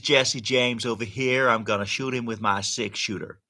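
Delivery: put-on hammy Wild West cowboy accent. Doubtful cowboy attempt for a viewer, cowboy accent. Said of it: "I wasn't sure whether that was a cowboy".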